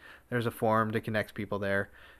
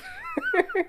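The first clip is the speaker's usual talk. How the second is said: high laugh. Real, not acted. Creeped out by sock.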